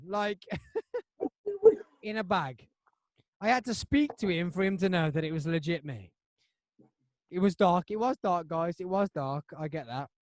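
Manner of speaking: ordinary talk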